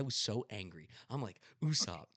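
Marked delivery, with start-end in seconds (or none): 1.6-2.1 retold anger, hot at first then settling; fuming rant. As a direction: tell him off as if still furious. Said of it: "I'm, I'm fuming".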